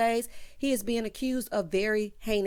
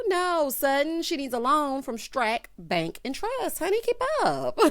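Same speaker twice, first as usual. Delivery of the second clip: sassy retort; 6 semitones higher than usual. Retold or imitated. Recapper answering Sutton's question, sassy and high. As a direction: talk down to her, honey.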